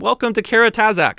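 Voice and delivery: high, breath-heavy mock announcer; conference introducer. Introducing an author at a conference, mock announcer welcome. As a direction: imitate a stage welcome in a high whispered call.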